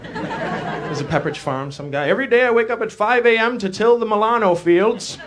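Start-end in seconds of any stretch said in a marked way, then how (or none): 2.1-5.2 farmer voice. Acted.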